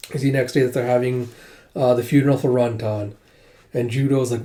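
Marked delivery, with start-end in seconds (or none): none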